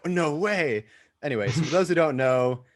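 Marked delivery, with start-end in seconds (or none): 0.1-0.9 delighted high-pitched exclamation, retold; happy disbelief. Podcaster retelling his reaction to co-hosts, happy disbelief. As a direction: imitate your own thrilled reaction. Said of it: "I was so happy".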